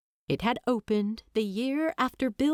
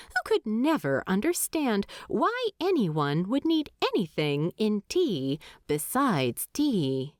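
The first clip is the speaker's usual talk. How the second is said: ordinary delivery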